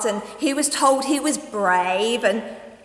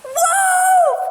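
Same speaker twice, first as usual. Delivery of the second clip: high, loud screaming wolf; terrified scream; 10 dB louder than usual. Acted. Boy alone screaming for help as the wolf nears. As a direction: scream it in terror. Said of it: "screamed the boy".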